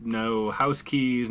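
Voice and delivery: usual speaking voice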